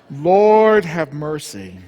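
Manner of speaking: slow loud lord have mercy; exasperated. Real, not acted. Teller exasperated at his mother, slow and loud. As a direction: groan it out, drawn long.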